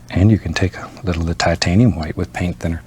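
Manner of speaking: ordinary talk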